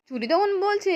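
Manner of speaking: plain reading voice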